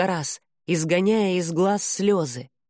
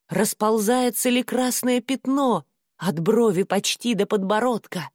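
ordinary delivery, nothing marked

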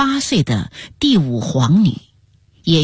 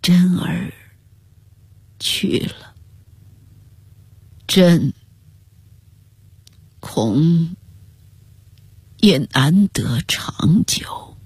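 halting hushed words, long pauses; soft murmur; slower than usual. A narrator's reading. Grieving emperor confiding in his attendant, softly and haltingly. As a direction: let each phrase fade slightly, breath showing. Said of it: "宪宗轻声说道".

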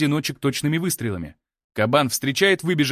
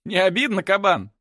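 smirking tease; 5 semitones higher than usual. A narrator's reading. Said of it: "В канале слышится усмешка змея".

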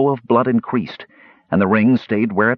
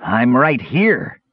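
slow, higher creature voice; kender taunt; 4 semitones higher, 3 dB louder, much slower than usual. A narrator's reading. Kender answering the fighter before leaping, slow drawn-out taunt. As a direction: stretch it out as you spring. Kender reveals himself, then attacks.

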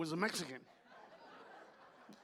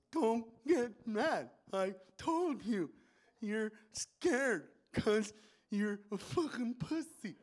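Marcus; 3 semitones higher, much slower than usual. Acted. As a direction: drag each word out with effort, pausing between.

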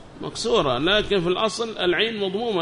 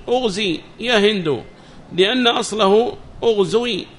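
higher, louder recitation of a book; reading text aloud; 5 semitones higher, 6 dB louder than usual. Acted. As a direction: read the text out to the class, raised and measured. (قراءة المتن بصوت مرتفع)

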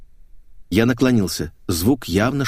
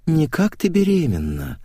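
higher, lilting purr; mocking purr; 7 semitones higher than usual. A narrator's reading. Natasha needles her queasy friend, higher purr. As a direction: purr the suggestion. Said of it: "промурлыкала Наташа".

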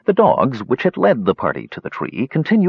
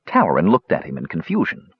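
ordinary delivery, nothing marked

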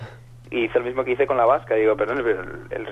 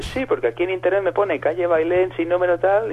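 higher Basque caller voice; imitated Basque accent; 7 semitones higher than usual. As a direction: put on the Basque woman's phone voice. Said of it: "acento vasco". (voz aguda imitando a la llamante vasca)

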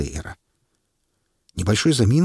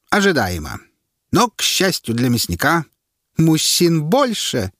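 herald summing up; 8 semitones higher than usual. A narrator's reading. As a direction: spell out the verdict to the crowd. Herald sums up.

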